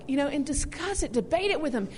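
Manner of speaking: ordinary talk, nothing marked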